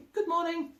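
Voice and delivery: high, bright good-morning from Elmer; smiling greeting. A narrator's reading. Elmer greets the jungle animals, smiling, in a high voice. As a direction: say it with a smile. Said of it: "Elmer smiled and said".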